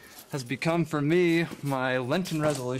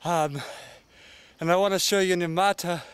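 talking while panting uphill; winded from the climb; out of breath; 4 semitones higher, 4 dB louder than usual. Real, not acted. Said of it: "we're halfway up the hill".

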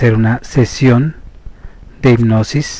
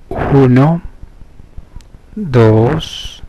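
slow, measured hypnosis voice with long pauses; guided meditation; slower than usual. Acted. Therapist leading listeners into hypnosis through slow breathing, measured meditation voice. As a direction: guide them inward, stretching the counts and key words.

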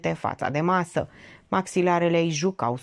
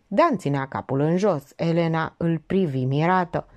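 ordinary delivery

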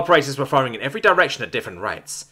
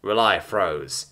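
ordinary delivery

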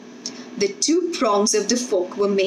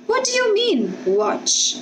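wavering; 12 semitones higher than usual. A narrator's reading.

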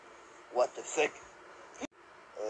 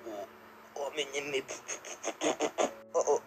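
ordinary talk

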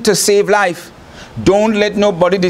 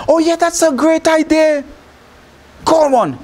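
much higher, delighted princess; Pharaoh's daughter agreeing; 9 semitones higher than usual. Retold or imitated. Pharaoh's daughter answering Moses' sister, much higher and delighted. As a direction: squeal a bright yes up high. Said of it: "She said".

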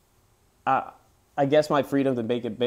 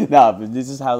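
laughing; 2 semitones higher, 12 dB louder than usual. Real, not acted. Still laughing after alarm.

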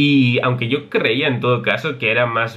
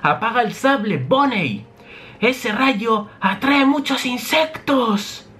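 much higher, slow scientist voice; Vegapunk warning; 12 semitones higher, slower than usual. Acted. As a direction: warn her calmly but oddly. Vegapunk warns Bonnie.

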